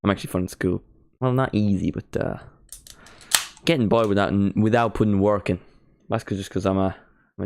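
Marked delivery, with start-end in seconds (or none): none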